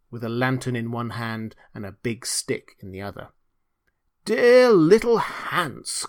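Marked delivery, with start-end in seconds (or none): 4.2-6.1 high, loud, drawn-out appeal; urgent cry. A narrator's reading. Miller at Hans's door at night, urgent cry. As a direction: cry out for a friend's help. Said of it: "cried the miller".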